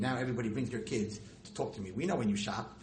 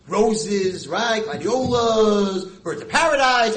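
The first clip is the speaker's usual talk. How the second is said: loud, high, rapturous listing of flowers; showing off the flower store's glory, thrilled and loud; excited rave; 9 semitones higher, 13 dB louder, much slower than usual. Real, not acted. Rabbi rhapsodizing about the flower store, loud and high. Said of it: "I don't wanna show off".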